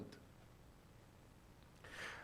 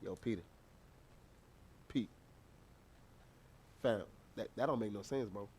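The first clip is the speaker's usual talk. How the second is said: much lower, softer street-slang doubter; skeptical disciple; 12 semitones lower, 15 dB quieter, faster than usual. Pretend. Disciples in the boat doubting Peter, low and skeptical. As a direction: mutter it like a doubtful friend in the boat. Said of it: "imagine everybody now watching".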